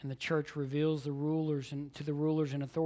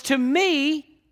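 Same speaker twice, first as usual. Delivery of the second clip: "To me" pitched high and held; emphatic; 10 semitones higher, 10 dB louder than usual. Retold or imitated. Preacher returning to the apostle's opening words, emphatic. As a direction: make "To me" stand out alone.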